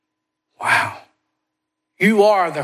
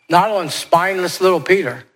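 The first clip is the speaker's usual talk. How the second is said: ordinary delivery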